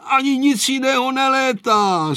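high, drawn-out jolly teasing; villagers' merry banter without actual laughter; amused neighbours. A narrator's reading. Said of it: "smáli se sousedé".

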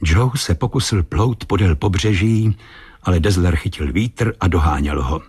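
plain reading voice

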